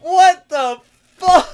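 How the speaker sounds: shocked shout. Retold or imitated. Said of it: "you're just like".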